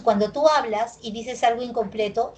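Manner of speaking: ordinary talk, nothing marked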